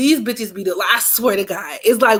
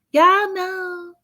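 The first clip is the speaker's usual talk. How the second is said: high put-on sweet voice, much higher; fake girly voice; 9 semitones higher than usual. Mocking the friend's fake voice around the guys, high cooing. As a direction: coo it in a sugary voice. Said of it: "your mother fake ass fucking voice".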